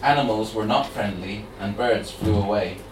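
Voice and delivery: measured, gentle poem read; reading verse at an open mic. Acted. Host reads poem aloud.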